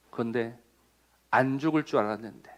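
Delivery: low, soft musing to oneself; quiet disbelief. Acted. Preacher voicing someone who thought death would pass them by, quiet disbelief. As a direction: say it small and low, as a dawning admission.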